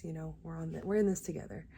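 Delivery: ordinary delivery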